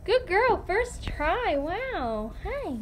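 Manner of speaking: high, swooping pet praise; praising a pet. Real, not acted. Owner praises Callie's first try, high cooing praise. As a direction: coo praise at a clever pet. Praising her pet's trick.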